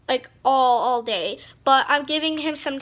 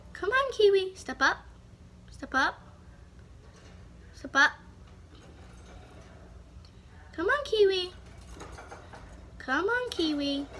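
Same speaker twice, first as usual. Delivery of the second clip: coaxing a pet; 5 semitones higher, 4 dB quieter than usual. Real, not acted. Coaxing the bird again.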